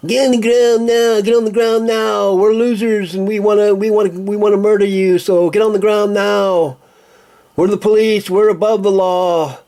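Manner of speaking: high, loud mocking cop yell; mocking police shout. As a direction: shout orders like a bullying cop.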